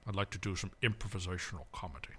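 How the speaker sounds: low whispered Liam Neeson; breathy gravel-free hush, fast; Liam Neeson impression. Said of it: "Let's hear your Liam Neeson".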